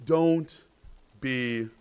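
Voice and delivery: gentle, fading to a hush on afraid; reassuring Jesus. Acted. Jesus comforting the sinking disciples.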